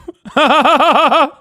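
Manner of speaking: sudden high, loud laugh; loud burst of laughter. Real, not acted. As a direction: crack up out loud at the punchline. Streamer laughs at the story.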